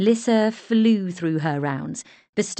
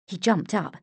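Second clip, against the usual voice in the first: ordinary delivery, nothing marked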